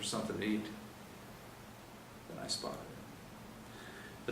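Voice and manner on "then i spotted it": unvoiced whisper, very slow; hushed slow whisper of the discovery. A narrator's reading. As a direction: whisper the find slowly.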